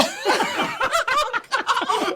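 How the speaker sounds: shrieks of laughter, way up high; high shrieking laugh. Real, not acted. Friend laughing at the stun gun story with a high shriek.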